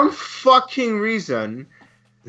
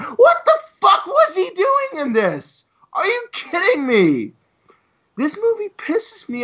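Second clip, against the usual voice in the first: furious shout; 12 semitones higher than usual. Real, not acted. Raging to cohosts about Jesse Eisenberg, outraged shout. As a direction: bellow it in disbelief.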